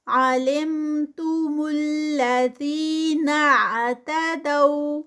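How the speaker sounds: عَلِمْتُمُ الَّذِينَ اعْتَدَوْا slow, with الَّذِي stretched two counts; Quran recitation. Shown as a demonstration. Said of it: "ٹو counts کا stretch".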